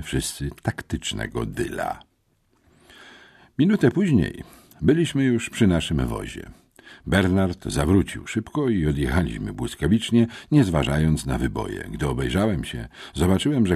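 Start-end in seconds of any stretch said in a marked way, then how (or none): none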